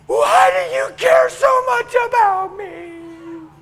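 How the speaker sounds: high, loud, slow wail of her question; hammed-up tearful outcry; crying out. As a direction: cry it out like a broken woman. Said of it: "burst out crying, and she said".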